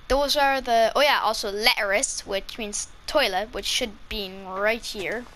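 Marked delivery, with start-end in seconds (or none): none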